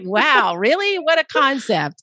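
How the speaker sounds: sarcastic wow, rising way up high; mock amazement. Guest mocks her old self discovering carbs, high sarcastic wow. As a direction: play it as a gag of delighted surprise. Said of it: "it's just like".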